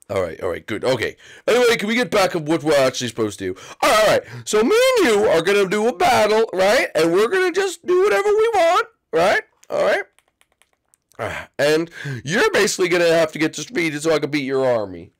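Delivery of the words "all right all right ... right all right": high, eager little Koopa promise; Iggy voice. Acted. Iggy promising his brother Roy he'll be good, high and eager. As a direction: promise sweetly to behave.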